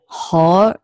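猴儿 with the 儿 curled onto 猴; 猴儿 said as one syllable with a curled tongue; Beijing erhua. Shown as a demonstration. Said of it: "你发一下猴".

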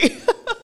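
high bursting laugh at herself; burst of laughter. Real, not acted. Amused at her poor school, a high burst of laughter.

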